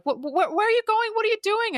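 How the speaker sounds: high-pitched, stammering questions; imitated flustered mum. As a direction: mimic a mother fretting, voice shooting up.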